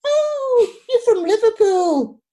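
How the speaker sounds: spring it on him with a delighted mock gasp; mock surprise. Hostess catching the new guy out at her party, high mock surprise. Teasing reply at garden party.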